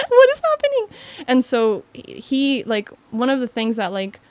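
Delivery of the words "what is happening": panicked laugh. Retold or imitated. Panicked reaction to meeting owner.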